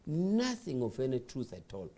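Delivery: ordinary talk, nothing marked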